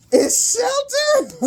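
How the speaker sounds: very high, slow squeal; laughing shout. Real, not acted. Reader naming the next card, squealing with laughter. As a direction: squeal the word out through laughter. Reading cards while laughing.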